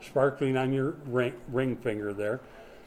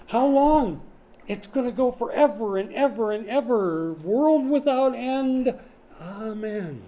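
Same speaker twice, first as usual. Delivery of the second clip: preach; 7 semitones higher, 3 dB louder than usual. Acted. Preacher proclaiming eternity.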